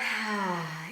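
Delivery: long releasing exhale; audible sigh. Shown as a demonstration. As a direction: sigh the breath out fully. Models a deep breath out.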